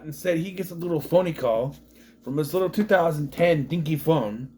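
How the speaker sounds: ordinary talk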